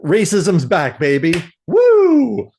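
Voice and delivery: sarcastic cheer rising to a loud whoop; mock celebration. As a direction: cheer it like a party host. Mock cheer about racism.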